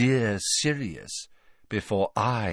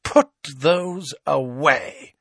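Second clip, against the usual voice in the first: slow, cold command, higher in pitch; 7 semitones higher, slower than usual. A narrator's reading. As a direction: order them icily, word by word. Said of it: "she said coldly to Harry and Ron".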